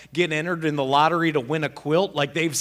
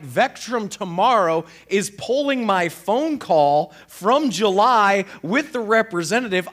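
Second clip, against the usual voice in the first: usual speaking voice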